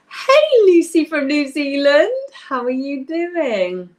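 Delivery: high, drawn-out delighted hello; real excited squeal of welcome, opening loud; excited greeting. Real, not acted. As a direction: greet a long-missed friend far away, high and stretched. Delighted greeting to a distant viewer.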